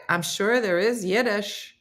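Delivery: normal talking voice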